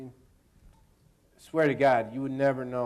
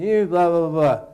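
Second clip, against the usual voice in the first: louder, higher mock yell; 5 semitones higher, 11 dB louder than usual. Yelling at Leo in the scene, louder mock yell. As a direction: yell the lines at him. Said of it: "I'm yelling at him".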